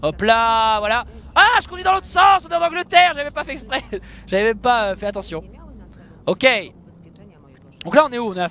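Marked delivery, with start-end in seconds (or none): 1.3-3.8 startled realization. Real, not acted. Realizing wrong lane.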